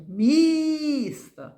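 МІСТО with a very high, loud, long І; loudest sound stressed. Shown as a demonstration. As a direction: call МІСТО out, leaning hard on МІ. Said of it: "найголосніше".

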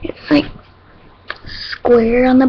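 talking with lips pulled down; fingers stretching lips. Real, not acted. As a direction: fingers in mouth corners. Seen 0: fingers pull lower lip down at start, later finger at lip.

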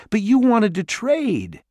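high, loud disbelief; shocked protest. A narrator's reading. Jared protesting the Dwarf Lord's trick, high and shocked. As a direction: protest the betrayal.

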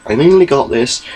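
usual speaking voice

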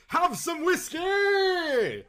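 high, loud, slow hearty shout; hearty offer. A narrator's reading. Earl heartily pressing whiskey on his nervous guest. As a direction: boom out the offer. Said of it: "said Lord Clincham".

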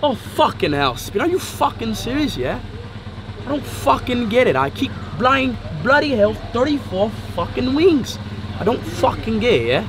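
exasperated, put-on British accent. Shown as a demonstration. Brit griping about his wings order, mock British accent. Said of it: "U K".

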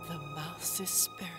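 normal talking voice

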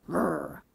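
low quiet rumble; a hungry stomach; belly growl. Acted. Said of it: "his belly growled too".

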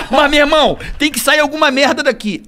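high laughing storytelling; laughing while telling. Real, not acted. Telling the friends about the bad [name] impression, laughing. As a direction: tell it through laughter.

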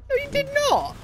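indignant. Real, not acted. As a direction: squeal a denial. Quarrel over callout.

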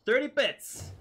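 thrilled shout over a small donation; excited cheer. Real, not acted. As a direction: burst out happily at the donation.

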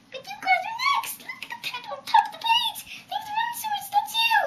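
squeaky high pleading cry; frantic little character, close to tears. Acted.

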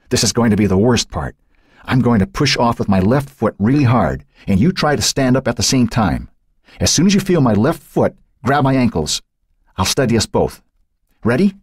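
lightly voiced boy giving directions in the dark; Matt's voice. A narrator's reading. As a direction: coach her up onto his shoulders, a boy trying to sound sure. Matt giving instructions.

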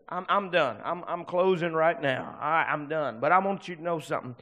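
ordinary talk

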